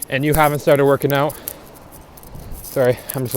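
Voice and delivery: slightly winded from walking; a little out of breath, breathy. Real, not acted. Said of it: "a little out of breath".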